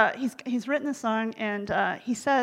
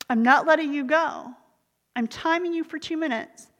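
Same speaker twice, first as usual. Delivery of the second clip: firm, raised-pitch refusal to let go; acted friendly insistence; insistent friend; 5 semitones higher, 5 dB louder than usual. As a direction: lay down the rule to a reluctant friend. Friend insists on holding the hug.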